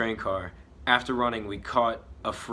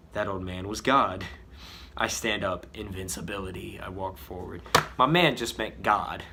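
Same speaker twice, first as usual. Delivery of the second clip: ordinary delivery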